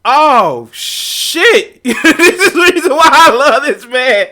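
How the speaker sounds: loud, high delighted laugh, words slowed; bursting out laughing in admiration. Real, not acted. Delighted reaction to guest's retort.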